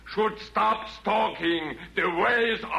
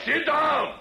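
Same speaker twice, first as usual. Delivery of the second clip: high, louder angry command; angry order; 8 semitones higher, 3 dB louder than usual. Acted. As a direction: slam him back into his seat. Demons quarrel in meeting.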